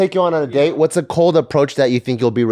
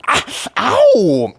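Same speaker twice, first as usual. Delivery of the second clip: mock yelp of pain; 8 semitones higher, 4 dB louder than usual. Paramedic pinches finger.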